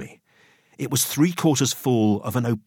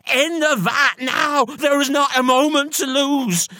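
high, drawn-out frantic yell; panicked woman's urgent cry; 14 semitones higher, 4 dB louder than usual. A narrator's reading. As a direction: yell it as a desperate command. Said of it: "Missus Ruddock was yelling at me".

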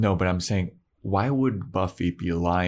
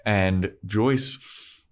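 ordinary talk, nothing marked

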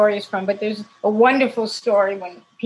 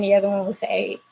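ordinary delivery

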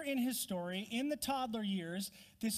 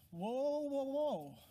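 startled whoa; 6 semitones higher, much slower than usual. Acted. Reacting to the angel's Egypt order.

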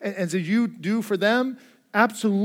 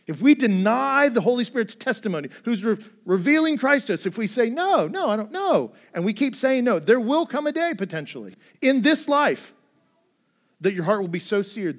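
louder, higher preaching voice, driving each line home; full-force preaching; 5 semitones higher, 5 dB louder than usual. Acted. Pastor warning his congregation against sin, loud fervent preaching.

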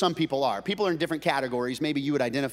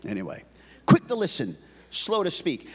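ordinary talk, nothing marked

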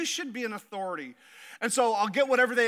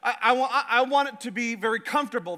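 usual speaking voice

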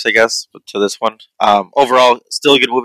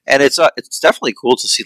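ordinary talk

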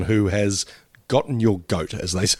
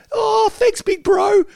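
put-on much higher kid-brother voice; imitating a little kid, voice pitched way up; 4 dB louder, slower than usual. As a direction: play a grateful small boy. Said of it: "player's little brother at home".

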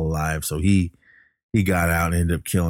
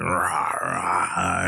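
dog growl; 6 dB quieter than usual. Retold or imitated. Imitating the dog turning into a demon, a soft growl. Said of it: "the dog's like".

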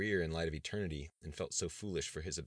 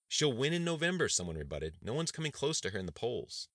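much higher, louder sharp rebuttal; 7 semitones higher, 4 dB louder than usual. Acted. Cabinet member rebuts the budget director, sharp and high. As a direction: cut in to contradict. Someone rebuts across table.

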